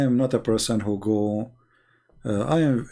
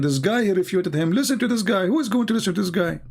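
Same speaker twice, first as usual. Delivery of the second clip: higher-pitched imitation of scoffing critics; mock dismissive; 7 semitones higher than usual. Critics tell viewers not to listen to him, mock dismissive. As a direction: mimic detractors waving someone off, pitched up.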